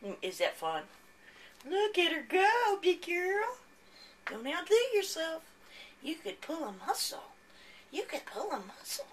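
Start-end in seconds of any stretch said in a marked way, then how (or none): 1.7-9.1 talking to a pet. Real, not acted. Cheering cat on.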